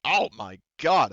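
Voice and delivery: loud, slow astonished outburst; shocked exclamation. Real, not acted. GM rolls the crit for Evelyn, loudly astonished. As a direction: let the shock spill out.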